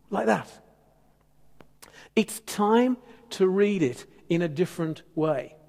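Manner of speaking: ordinary talk, nothing marked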